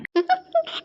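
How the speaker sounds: quick soft high giggle; high shy giggle. Acted. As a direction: giggle at the teasing. Laughs at being teased.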